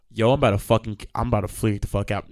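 ordinary talk, nothing marked

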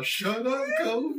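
lightly acted exasperated rebuke; mock scolding. Friend retelling Brian silencing him, mock scolding.

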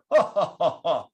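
loud, high mock laugh brushing it off; deflective laugh. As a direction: laugh it off to dodge the question. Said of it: "deflective reaction".

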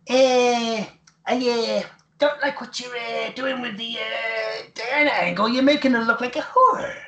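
high, croaky old-man impression, badly done; Stu Hart impression. Owen prank-calls Cornette as his father, terrible high Stu voice. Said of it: "That is a terrible Stu Hart impression".

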